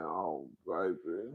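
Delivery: slow, soft, low lament; mock mourning. Quoting herself reacting to Kenya, slow mournful sigh. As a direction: stretch it out like grieving a friend. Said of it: "I said".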